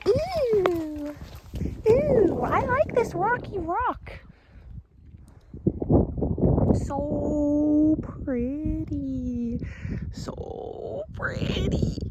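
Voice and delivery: high, drawn-out delighted coo. Real, not acted. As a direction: coo over something pretty. Admiring a rock, cooing.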